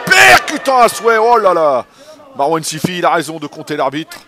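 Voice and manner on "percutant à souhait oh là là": excited shout. Real, not acted. Big punch lands in boxing.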